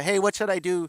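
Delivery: mock worried caller asking the teacher; mimicking a caller. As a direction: play the eager drummer on the phone. Students calling teacher for advice.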